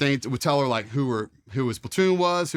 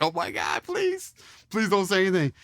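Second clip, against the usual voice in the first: panicked plea; 7 semitones higher than usual. Retold or imitated. Panicked plea as recruit steps out.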